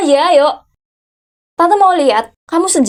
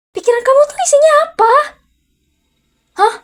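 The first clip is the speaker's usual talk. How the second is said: sharp, much higher outburst at the nephew; aunt exploding at her nephew, higher and sharper; exasperated scolding; 13 semitones higher than usual. Acted. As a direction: demand it in disbelief, voice shooting up.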